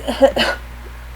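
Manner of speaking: a rough, wincing cough that hurts; painful cough. Real, not acted. Sore after surgery, coughing through the pain. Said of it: "It hurts to cough".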